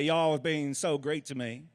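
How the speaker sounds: lightly done Southern American drawl, slow; a slow, easy Southern American accent, not overdone. Shown as a demonstration. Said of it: "an American accent from you".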